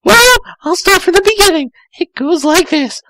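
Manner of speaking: put-on high, fast girlish voice; Tails, sweet and eager; young girl Tails. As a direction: chirp it quickly like a young fox girl. Tails explains.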